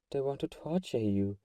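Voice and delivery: ordinary delivery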